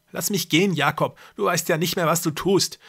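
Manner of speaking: put-on a frightened girl trying to sound firm; cornered girl standing her ground. A narrator's reading. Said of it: "so bestimmt es einer bedrängten Dreizehnjährigen".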